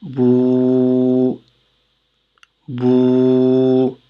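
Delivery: bou held long; long vowel bou. Shown as a demonstration. Teacher repeating the long vowel bou, stretched. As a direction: draw out the ou in bou. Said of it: "on dit bou".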